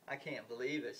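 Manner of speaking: ordinary talk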